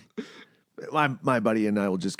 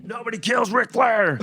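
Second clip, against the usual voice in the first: much higher, slow promo voice; mock wrestler boast; 12 semitones higher than usual. Answering the hospital story, slow hammed-up promo.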